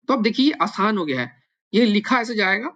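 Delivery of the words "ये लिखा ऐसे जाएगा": usual speaking voice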